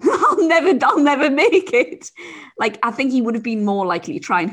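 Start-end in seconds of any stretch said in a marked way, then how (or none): 0.0-2.1 slow, higher, louder wail of doom; mock despair. Horse imagined despairing at the fence, slow mock wail. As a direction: ham up the horse's panic. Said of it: "He'll have got there and just gone".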